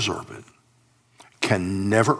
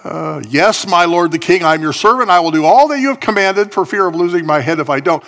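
louder, higher grudging snarl of a servant; 8 semitones higher, 7 dB louder than usual. Acted. Servant Ziba answering the king with a snarl. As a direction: spit it out like a resentful servant forced to obey. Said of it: "Ziba snarled, Ziba grunted".

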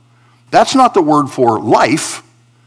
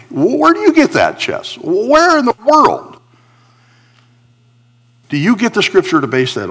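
incredulous challenge; 7 semitones higher than usual. Acted. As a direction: question it like a baffled listener. Voices a listener challenging the preacher.

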